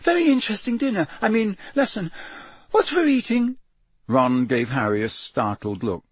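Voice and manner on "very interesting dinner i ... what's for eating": fast, much higher jumbled chatter; gabbling high. Acted. Neville gabbles nonsense to friends, fast and much higher. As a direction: tumble over the words. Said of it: "gabbled in the same unnaturally high voice".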